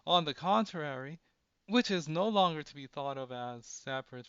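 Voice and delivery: loud, grand formal reading; declaiming reading. Acted. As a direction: proclaim it like an orator.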